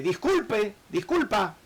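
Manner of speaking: a sheepish servant saying sorry; apologetic plea. Retold or imitated.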